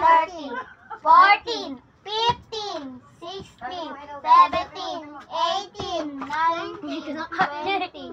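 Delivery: counting out in a loud voice. Real, not acted. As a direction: call out each number loudly. Counting loudly during a challenge.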